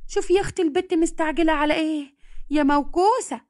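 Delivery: high, mocking old woman's jab; a grandmother mocking the girl. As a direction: scold like a cranky granny.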